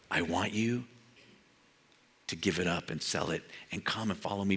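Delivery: soft and low, gentle; tender Jesus voice. Pretend. Preacher voicing Jesus asking a hunter to give it up. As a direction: invite them gently to follow.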